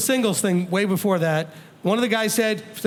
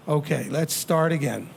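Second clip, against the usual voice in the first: soft, slow and lower, soothing a spouse; calm and gentle; 6 semitones lower, 3 dB quieter than usual. Shown as a demonstration. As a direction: gently pull them back in, slowly. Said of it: "when you walk over calmly".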